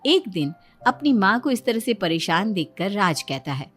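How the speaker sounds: ordinary delivery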